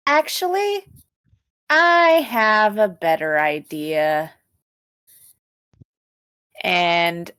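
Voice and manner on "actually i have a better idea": evil scheming. Acted. Dahlia announcing her better plan, evil and scheming. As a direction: sly lift on the opening, then slow and drawn out. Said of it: "gets an evil grin on her face".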